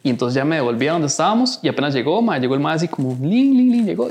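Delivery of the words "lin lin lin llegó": imitated sneaking sound.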